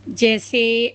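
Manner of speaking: ordinary talk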